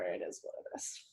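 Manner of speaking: whispered shrug, letting it go; resigned whisper. Real, not acted. Shrugging off the chip talk to her friend, whispered. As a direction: breathe it out, barely voiced.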